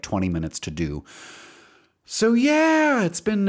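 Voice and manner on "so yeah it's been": much higher, louder put-on squeak; a quick squeaky aside; goofy high voice again; silly high voice. Brief return of high silly voice.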